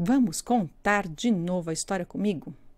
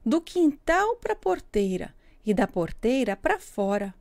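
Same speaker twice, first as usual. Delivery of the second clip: ordinary delivery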